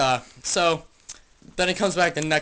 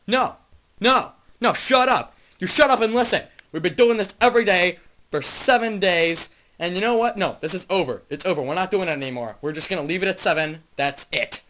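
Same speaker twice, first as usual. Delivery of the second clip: loud, higher telling-off; fed-up angry shouting; 3 semitones higher, 7 dB louder than usual. Acted. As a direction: cut him off and end it, furious. Dude snaps at friend, acted quarrel.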